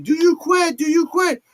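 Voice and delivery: loud, high ref demand; ref yelling. Retold or imitated. Ref shouting at Wendy to quit. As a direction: shout the question at her like the ref. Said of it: "I don't know how zombies talk".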